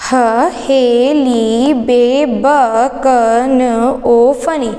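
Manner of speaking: stretched syllables, evenly spaced; slow mnemonic recitation. Shown as a demonstration. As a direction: draw out each syllable for learners to follow. Teacher recites mnemonic slowly, then joined. (अक्षरों को खींचकर, धीरे-धीरे)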